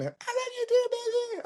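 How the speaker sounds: squeaky lovey-dovey falsetto; hammed-up falsetto sweetness; doting girlfriend. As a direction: answer in a very high mock girlfriend voice. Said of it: "one of the girlfriends was like".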